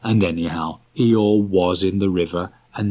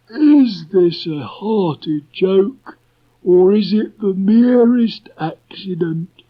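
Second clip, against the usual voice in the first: much higher, louder and slow, a mournful donkey; slow, high, gloomy Eeyore voice; 12 semitones higher, 6 dB louder, slower than usual. A narrator's reading. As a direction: drag it out like a gloomy old donkey.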